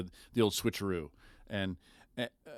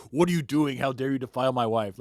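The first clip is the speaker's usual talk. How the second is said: mock outraged husband; 3 semitones higher, 4 dB louder than usual. A soap husband catching another man with his wife, mock outrage. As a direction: ham up a jealous husband's outrage.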